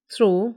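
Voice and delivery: थ्रो with an aspirated th at the start; TH sound. Shown as a demonstration. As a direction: model थ्रो clearly, th in place of t. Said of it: "टी की आवाज़ थ हो जाती".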